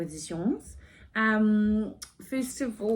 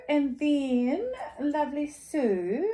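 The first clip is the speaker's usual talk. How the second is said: put-on French accent; 6 semitones higher, slower than usual. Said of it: "I have terrible French accent".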